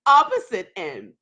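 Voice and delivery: ordinary talk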